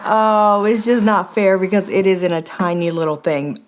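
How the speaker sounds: ordinary talk, nothing marked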